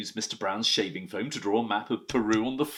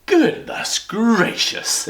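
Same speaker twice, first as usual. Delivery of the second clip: very high, slow shocked cry; shocked housekeeper exclaiming loudly; 13 semitones higher, 10 dB louder, much slower than usual. Acted. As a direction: gasp it out, scandalised. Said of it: "exclaimed Missus Bird".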